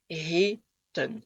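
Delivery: "reten" slowly in two syllables, long "é" then short "eu". Shown as a demonstration. Teacher breaking the word down, long then short. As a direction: split "reten": stretched first syllable, short ending. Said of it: "son long, son court".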